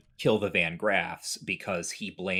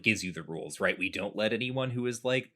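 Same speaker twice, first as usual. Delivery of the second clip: normal talking voice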